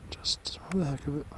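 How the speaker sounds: hushed whisper in the woods. Real, not acted. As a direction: whisper so nothing hears. Quiet scouting in woods.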